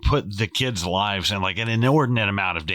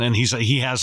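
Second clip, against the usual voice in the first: usual speaking voice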